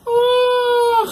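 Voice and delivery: high, loud, held comic cry; exasperated mock scream. Torturer thwarted by a silent prisoner, loud high mock scream. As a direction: let out a long theatrical "aargh".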